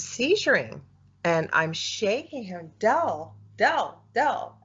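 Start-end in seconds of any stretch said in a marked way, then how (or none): 2.8-4.5 higher, retold calls to wake someone; re-enacted urgent name-calling. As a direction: call a name as if rousing a sleeper. Calling to wake seizing partner.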